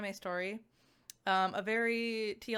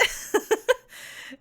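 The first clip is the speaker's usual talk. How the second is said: high giggle; 14 semitones higher, 10 dB louder than usual. Real, not acted. Giggle mid sentence.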